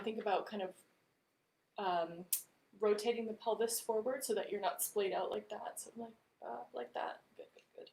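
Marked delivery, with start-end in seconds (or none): 5.8-7.9 soft unvoiced whisper. Real, not acted. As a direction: drop to a whisper. Demonstrating the pose to the class.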